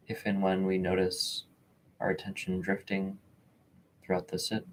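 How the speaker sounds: calm, measured meditation voice with long pauses; unhurried, even delivery, leaving space between phrases; guided meditation. Real, not acted. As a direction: gently guide listeners into stillness, phrase by phrase.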